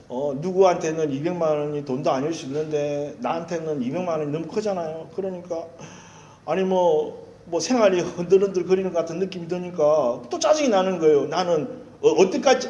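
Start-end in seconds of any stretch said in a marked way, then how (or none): none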